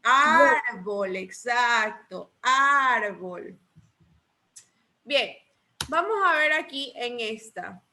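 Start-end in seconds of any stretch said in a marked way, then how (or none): 0.0-1.2 "Árbol" with a long ÁR, then short bol; stress on first syllable. Shown as a demonstration. Teacher confirming the pupil's answer, "Árbol" stressed on a drawn-out ÁR. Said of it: "tiene sílaba tónica y sílaba átona". 2.4-3.6 "árbol" slowly, ÁR held long before bol; stress on first syllable. Shown as a demonstration. Teacher repeating the word for the class, "árbol" with a long stressed ÁR. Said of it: "tiene sílaba tónica y sílaba átona".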